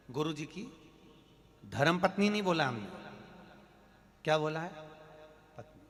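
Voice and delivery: ordinary delivery, nothing marked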